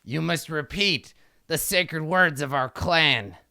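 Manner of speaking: slow, ceremonial pronouncement; imperious kobold king. Acted. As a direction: intone it like a pompous ruler. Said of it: "and he says".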